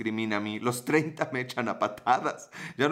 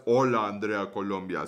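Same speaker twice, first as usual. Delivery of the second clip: hola stretched long and low like a tough guy; mock macho greeting; 5 semitones lower than usual. Shown as a demonstration. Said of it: "Tú dirás".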